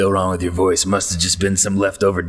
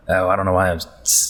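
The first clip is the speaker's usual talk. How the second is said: ordinary talk, nothing marked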